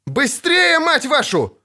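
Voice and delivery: high-pitched impatient yell; орать. A narrator's reading. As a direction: yell at them, cursing and urgent. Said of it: "орал Лютик". (орать, подгоняя с руганью)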